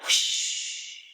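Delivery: breathy whoosh; whispered whoosh. A narrator's reading. Narrator whispers the sandstorm sound. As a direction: blow the sandstorm.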